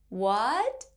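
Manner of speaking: "What" sweeping upward like a question; rising tone. Shown as a demonstration. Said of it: "It's similar to when we say".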